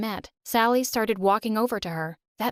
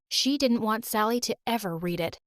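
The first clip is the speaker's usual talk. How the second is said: usual speaking voice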